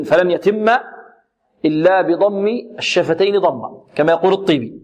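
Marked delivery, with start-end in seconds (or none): none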